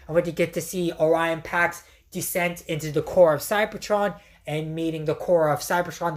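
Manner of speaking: ordinary talk, nothing marked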